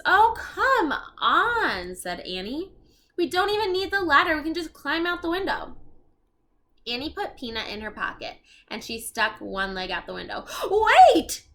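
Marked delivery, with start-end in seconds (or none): 0.0-2.0 high, loud, slow drawn-out coaxing; impatient girl. Acted. Annie urging Jack to hurry, high drawn-out coaxing. 3.2-5.8 put-on high, fast excited girl voice; eager girl. Annie telling Jack to skip the ladder, fast eager girl. 10.7-11.5 high, loud shout of alarm. Acted. Jack grabbing Annie's leg, loud alarmed shout.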